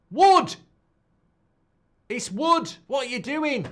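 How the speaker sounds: high, loud outburst of blame; exasperated shout. Real, not acted. Fan berating the defence over Wood, exasperated shout.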